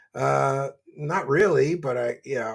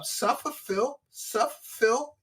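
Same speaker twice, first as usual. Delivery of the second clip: taunting singsong; 9 semitones higher, 4 dB quieter, slower than usual. Acted. Taunting Phil.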